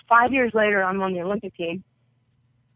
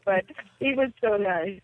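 normal talking voice

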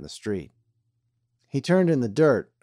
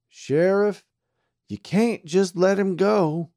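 pleading deputy; 10 semitones higher, 5 dB louder, slower than usual. A narrator's reading. Pete the deputy protests.

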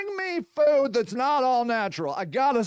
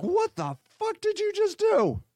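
high, appalled yelp; higher appalled outburst; shocked; 7 semitones higher than usual. Retold or imitated. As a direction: recoil in disgust at the dog. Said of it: "And you're like".

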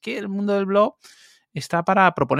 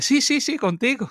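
much higher, eager yes yes yes; eager insistence; 9 semitones higher than usual. Retold or imitated. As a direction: insist brightly, up high. Javier insists people want him.

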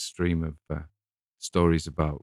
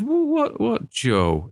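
sudden high, louder burst of disbelief; indignant surprise; 4 dB louder, much slower than usual. Retold or imitated. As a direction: squeak out a shocked what. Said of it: "another guy said".

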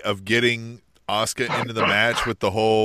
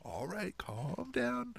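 higher, much softer soothing voice; calm the upset wrestlers down, higher and quieter; placating bookers; 7 semitones higher, 6 dB quieter than usual. Retold or imitated. Bookers calming wrestlers backstage, high and hushed. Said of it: "And they were like".

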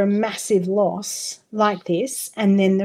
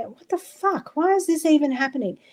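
bewildered inner voice; 6 semitones higher than usual. Retold or imitated. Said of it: "a big part of me going".

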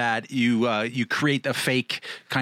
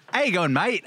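a hammed-up Aussie greeting, pitched higher; mock Australian, raised and sing-song; bad Australian accent; 8 semitones higher than usual. Telling friends about Aussies greeting him, mock Australian accent. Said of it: "No, it's terrible".

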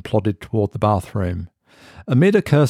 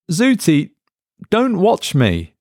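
higher and slow, pleading; uneasy plea; 10 semitones higher, slower than usual. A narrator's reading. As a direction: beg it to stop. Mark pleads with device.

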